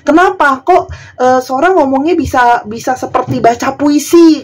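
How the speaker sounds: high mock puzzled complaint; mocking questioner. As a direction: ask it like a puzzled, nosy viewer. (meniru pertanyaan penonton dengan nada tinggi)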